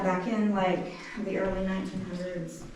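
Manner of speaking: usual speaking voice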